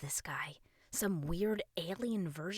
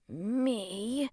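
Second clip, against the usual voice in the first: louder, higher questioning echo; mimicking; 5 semitones higher, 6 dB louder than usual. A narrator's reading. Boy echoes the king's syllable, mimicking. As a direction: copy the word uncertainly. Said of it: "Russ mimicked".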